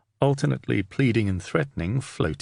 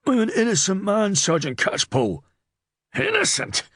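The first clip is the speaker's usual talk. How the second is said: very high, frightened protest; yelping whimper; 10 semitones higher than usual. A narrator's reading. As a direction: whimper your innocence in a high voice. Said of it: "Serlo yelped and whimpered".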